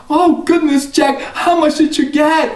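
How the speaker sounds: high, eager mother. Acted. Mother eagerly asks Jack what he got, high. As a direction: ask hopefully.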